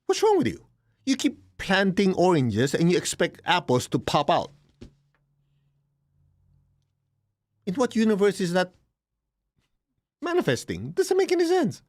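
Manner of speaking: incredulous teacher scolding a student; incredulous retort. Retold or imitated. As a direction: throw up your hands in mock outrage. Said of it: "I'm going".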